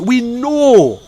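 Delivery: high, loud, drawn-out KNOW; emphatic cry. Real, not acted. Insisting the people know about ballots, emphatic cry. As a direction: cry out the known truth.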